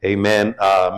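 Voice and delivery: ordinary talk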